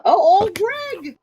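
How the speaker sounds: high, slow, creepy falsetto; Old Greg impression. Host quoting Old Greg, high slow falsetto. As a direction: coo it like a creepy swamp man.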